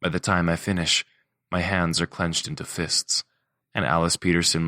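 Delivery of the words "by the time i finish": usual speaking voice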